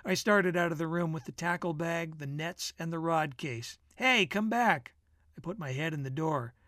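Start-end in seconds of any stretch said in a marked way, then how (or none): 4.0-4.9 raised, higher call to someone leaving. Acted. Bill to his friend leaving with the tackle, calling out. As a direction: call him back, pitched up a little. Bill calls Jake back into room.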